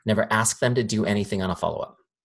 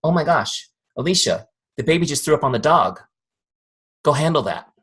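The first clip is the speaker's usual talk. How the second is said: higher mock alarm; urgent inner voice; 5 semitones higher, 4 dB louder than usual. Subconscious mind distracting Alicia from the message, urgent acted alarm. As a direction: sound a mock household emergency. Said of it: "Does her subconscious mind go".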